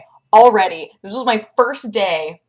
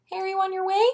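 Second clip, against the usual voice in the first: high mock voice for the worried producer; imitating producer; 13 semitones higher than usual. Vlogger imitating producer texting where are you. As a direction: play Jared checking in, lightly hammed. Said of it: "poor Jared, my producer, being like".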